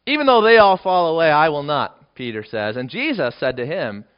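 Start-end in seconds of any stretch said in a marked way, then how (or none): 0.1-2.2 loud, high insistent claim; boastful vow. Acted. Peter insisting to Jesus he will not fall away, loud. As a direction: insist loudly you won't fail.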